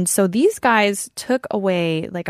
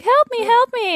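high, shrill mock cry for help; damsel in distress. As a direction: squeal it like a silent-film damsel. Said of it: "the woman, like, tied to the train tracks".

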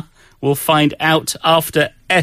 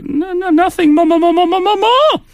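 put-on very high, squeaky child stammer; little girl voice. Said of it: "even when you're doing a Sonyo's voice".

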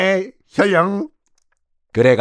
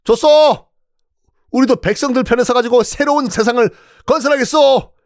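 high, raised commanding voice; 10 semitones higher than usual. Acted. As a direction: call out to the soldiers. Minister orders soldiers down.

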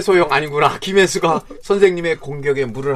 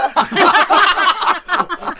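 high laughter; 3 dB louder than usual. Real, not acted. Big laugh.

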